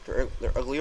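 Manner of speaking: ordinary talk, nothing marked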